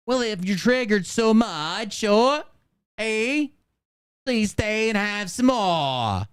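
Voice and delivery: high, slow, hammed-up Australian; badly faked Australian accent, vowels dragged out. Host guessing at the clip's accent, exaggerated mock Australian. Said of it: "This is my Australian accent".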